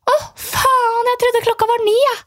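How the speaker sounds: mock girly oh no; imitating the girl's dismay. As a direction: play her startled and sheepish. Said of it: "Hun bare".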